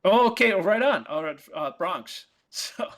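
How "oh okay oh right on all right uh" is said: usual speaking voice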